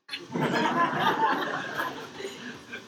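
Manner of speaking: breathy laugh at own joke. Real, not acted. Preacher chuckling at his own joke about the disciples.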